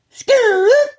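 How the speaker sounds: very high, loud whooped signal; shrill sustained call for help; gang distress call. Retold or imitated. Imitating gang member's call for backup, loud high whoop. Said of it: "He got a call".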